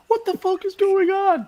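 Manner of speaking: high, startled yelp of disbelief; a villager suddenly seeing the body; shocked outburst. Acted. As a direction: blurt it out in high alarm. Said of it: "Lester's just".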